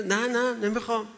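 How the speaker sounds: mock child clinging to a toy; child refusing. Speaker voicing a child who won't give up his toy, lightly whiny.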